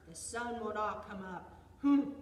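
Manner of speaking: loud bear chant, punched final huff; stubborn bear. A narrator's reading. Storyteller recalls bear's side of the contest, loud and stubborn. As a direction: state it firmly, then huff hard.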